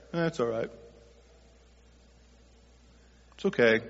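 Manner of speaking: low, quiet, shrugging verdict on the pie; a bored, lukewarm "Eh, it's all right"; damning the pie with faint praise; passe, unimpressed. Retold or imitated. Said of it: "just kind of passe about it".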